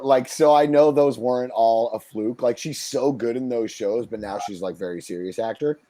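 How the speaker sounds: ordinary delivery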